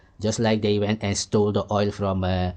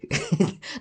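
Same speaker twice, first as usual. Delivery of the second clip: breathy scoffing chuckle; brief derisive snicker; short unvoiced laugh; 9 semitones higher than usual. Real, not acted. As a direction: let out a quick silent laugh. Laughs at his own point.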